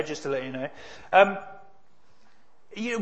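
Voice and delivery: ordinary delivery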